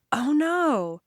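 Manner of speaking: acted dismay. Narrator reacting to juice on sister's laptop, acted dismay. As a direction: exclaim in storyteller's dismay.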